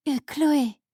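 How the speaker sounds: usual speaking voice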